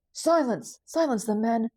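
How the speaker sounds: loud, high, urgent order; alarmed commander shouting his men into action; shouted command. Acted. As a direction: bellow it over a chanting crowd. Commander shouts over growing song.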